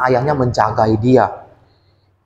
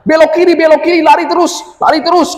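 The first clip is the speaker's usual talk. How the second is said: high, loud, urgent directions; father barking orders while running; shouted commands; 13 semitones higher, 4 dB louder than usual. Acted. (perintah berteriak, tinggi dan keras)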